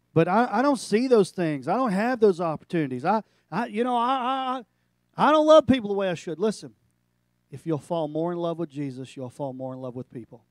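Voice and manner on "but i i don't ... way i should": high, loud, pleading churchgoer; mock struggling believer. Preacher voicing a frustrated listener, loud and pleading. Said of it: "You go".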